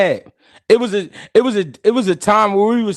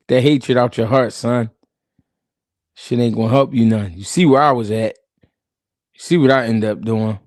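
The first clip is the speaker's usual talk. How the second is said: fatherly advice; 8 semitones lower than usual. Retold or imitated. Voicing Denzel advising a son.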